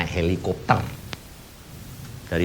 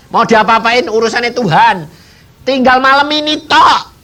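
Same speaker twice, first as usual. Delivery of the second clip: high, loud, urgent telling; fervent preaching; 11 semitones higher, 6 dB louder than usual. Real, not acted. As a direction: press the story home with fervour. Preacher tells emotionally of dying friend. (berkhotbah dengan penuh semangat)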